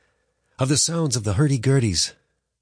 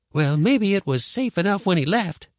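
high-pitched, reluctant grandma; grudging concession; 10 semitones higher, 4 dB louder than usual. A narrator's reading. Grandma giving in to the boy about safety, conceding. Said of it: "she conceded".

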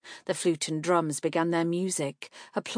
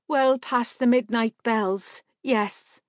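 woman's voice; 6 semitones higher, 5 dB louder, slower than usual. A narrator's reading. Marka's voice in story.

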